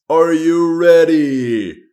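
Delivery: drawn out, loud and high like a ring announcer; hyped announcer call. Real, not acted. Host to viewers before the round, slow hyped shout. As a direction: stretch it out slowly to pump up the viewers.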